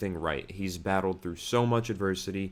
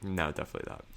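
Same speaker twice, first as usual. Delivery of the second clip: ordinary talk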